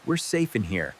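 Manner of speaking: low, soothing parent voice; reassuring. Acted. Dad telling child it's just rain, reassuring.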